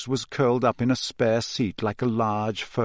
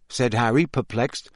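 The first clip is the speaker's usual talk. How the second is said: usual speaking voice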